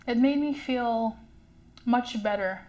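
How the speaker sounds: ordinary talk, nothing marked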